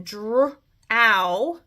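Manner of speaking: "dr-ow" split, stretching the ow; sounded out. Shown as a demonstration. Teacher to class, sounding out "dr-ow" with a stretched ow. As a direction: pull "dr-ow" apart to hear the ow. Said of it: "think about my ow again".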